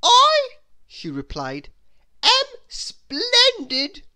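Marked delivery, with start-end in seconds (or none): 0.0-0.5 very high, very loud self-important "I"; a much higher, much louder lofty "I"; grand Miss Splendid. Acted. Miss Splendid introduces herself. 2.2-4.1 very high, loud and slow proclamation; a much higher, louder, slow boastful ending; haughty Miss Splendid. Acted. Miss Splendid introduces herself.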